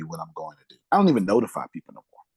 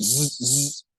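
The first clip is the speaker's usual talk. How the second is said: phone buzz imitation; 3 semitones higher than usual. Mimicking a buzzing phone.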